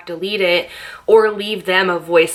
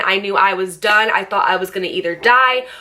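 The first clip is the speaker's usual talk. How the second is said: ordinary delivery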